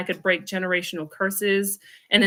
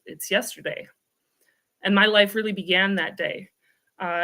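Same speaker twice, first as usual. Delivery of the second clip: usual speaking voice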